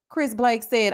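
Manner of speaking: ordinary talk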